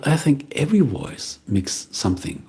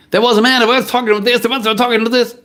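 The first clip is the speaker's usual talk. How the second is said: very high, thin presenter voice, louder; mosquito voice; 12 semitones higher, 5 dB louder, faster than usual. Retold or imitated. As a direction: imitate a whiny high presenter. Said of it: "he talks like a mosquito".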